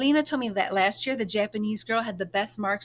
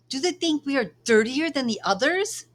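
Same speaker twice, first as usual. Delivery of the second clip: indignant question; 6 semitones higher, 7 dB louder than usual. Acted. Angry protest at pool rule.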